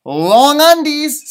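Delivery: slow, high long undies; silly drawn-out voice. Acted. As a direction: stretch it out goofily. Sam says long undies.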